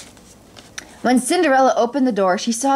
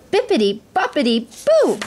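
higher, playful fairy spell; magical incantation; 6 semitones higher than usual. A narrator's reading. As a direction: chant it like a fairy godmother. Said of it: "Now for the magical words".

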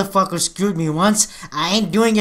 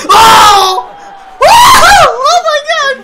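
very high, loud whooping; ecstatic cheer; 12 dB louder, much slower than usual. Real, not acted. Fan cheering at the screen, ecstatic whoop. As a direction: whoop like at a concert.

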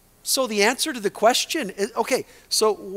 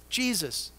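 usual speaking voice

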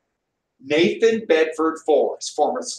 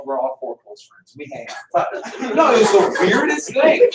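ordinary delivery

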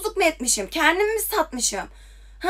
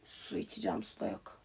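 muttered aside; 20 dB quieter than usual. Real, not acted.

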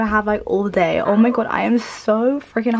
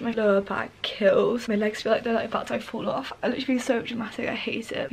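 much softer, drained sick voice; feeling ill; 8 dB quieter than usual. Real, not acted. Ill birthday girl complains to camera, quiet and drained. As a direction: barely get the words out, worn out. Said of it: "I feel super bad right now".

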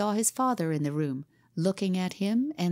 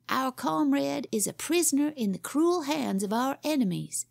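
put-on higher boyish voice, grand and rousing; boy pirate captain; 5 semitones higher than usual. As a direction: puff up like a pirate chief rallying his crew. Boy leader rallies gang.

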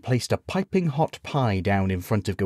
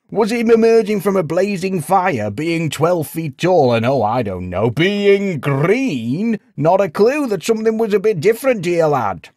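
high, loud mocking list; howling sarcasm; 10 semitones higher, 6 dB louder than usual. A narrator's reading. Villager ridicules the knight's excuse, loudly sarcastic. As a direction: pile up the obvious in loud sarcastic disbelief. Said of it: "as he howled".

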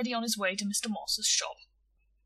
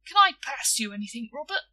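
higher, lilting sweet offer; sugary politeness, rising on the name; sweetly; 4 semitones higher, 7 dB louder than usual. A narrator's reading. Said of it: "he said sweetly".

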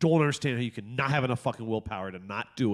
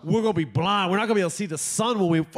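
high, giddy ranting promise; hammed-up overexcited dad; 6 semitones higher, 3 dB louder than usual. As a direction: pile it on with mounting comic glee. Hyped dad promising endless movie binge.

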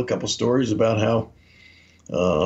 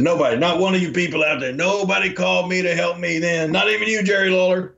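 imitated angry rant; 7 semitones higher than usual. Retold rant lambasting Jerry Lawler.

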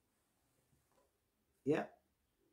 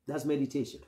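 ordinary delivery

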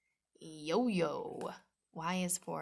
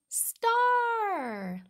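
excited reveal; 9 dB louder than usual. Acted. High, loud reveal of the star.

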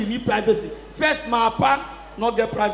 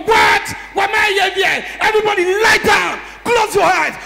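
loud, high barked orders; acted father's shouting; 6 semitones higher, 5 dB louder than usual. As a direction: bellow bedtime commands like a stern father. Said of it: "The way my father put us to sleep".

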